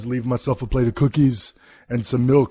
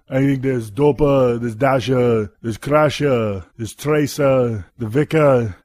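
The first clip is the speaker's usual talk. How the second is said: ordinary talk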